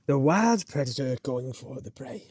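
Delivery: mock nature documentary voice over the river bank; nature documentary narrator. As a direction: narrate like a hushed wildlife presenter.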